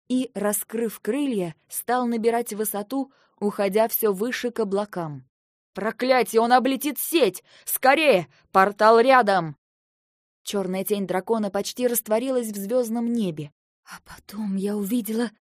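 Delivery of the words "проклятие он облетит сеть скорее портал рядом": urgent alarmed shout. Acted. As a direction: shout a warning as the dragon breaks free. Alarm as dragon escapes the net. (тревожный, срочный крик)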